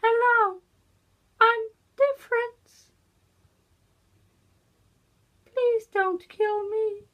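very high, tiny robot turret voice; thin, sky-high turret impression; turret from Portal. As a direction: squeak it like a little pleading turret. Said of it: "I can do the turret".

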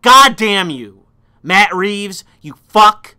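loud, slow curse at the director; angry cursing. Real, not acted. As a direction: spit the curse out slowly.

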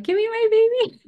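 high, loud excited demand; eager demand. Retold or imitated. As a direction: reach for the newborn. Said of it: "I was like".

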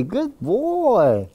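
put-on good boy sung out high and excited; happy dog praise. Shown as a demonstration. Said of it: "I'll praise him and let him know".

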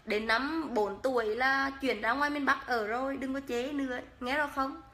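ordinary talk, nothing marked

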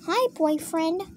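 high, soft lilting greeting; girlfriend figure. Pretend. Toy greeting her boyfriend, high soft voice. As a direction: greet high and softly, in play.

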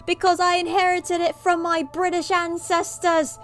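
exaggerated, bad British storyteller; worst British accent. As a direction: put on a hammy mock British voice. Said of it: "the worst British accent possible".

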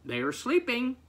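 high-pitched duck reply; a light, high animal voice; lightly voiced duck. A narrator's reading. Duck explaining to the farmer, lightly voiced high reply.

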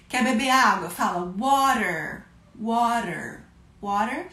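ordinary talk, nothing marked

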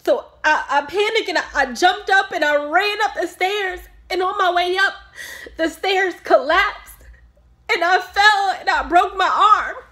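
loud, high, distraught recounting; panicked anguish. Acted. Mother telling doctor how the stairs collapsed, panicked. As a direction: relive the panic, voice straining upward.

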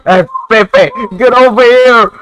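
loud, high call. Real, not acted. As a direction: call the dog over. Calling out.

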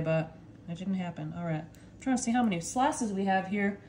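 ordinary talk, nothing marked

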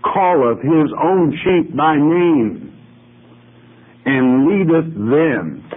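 "calleth his own sheep by name, and leadeth them" slow, deliberate, stress on "calleth"; repeated with emphasis. Shown as a demonstration. Preacher repeating scripture slowly for emphasis. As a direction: repeat the verse line slowly to drive it home. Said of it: "I repeat".

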